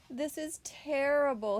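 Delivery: high, mock-tearful lament; moaning bear. A narrator's reading. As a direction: wail it like a sorry storybook bear. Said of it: "he moaned".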